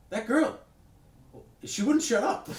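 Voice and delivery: high loud mock complaint through a laugh; imitating Kevin. Mocking Kevin's complaint about the screaming girl, high and loud. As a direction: whine it high and loud, cracking up. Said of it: "he goes".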